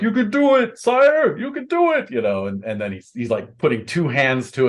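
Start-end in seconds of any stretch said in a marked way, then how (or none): 0.0-2.1 high-pitched cheerleading cry; cheering knights. Acted. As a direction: urge your king on, high and loud. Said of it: "his knights are like".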